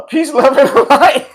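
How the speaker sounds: sign-off laughed out high and loud; real laughter breaking into the farewell; laughing. Real, not acted. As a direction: giggle through the words.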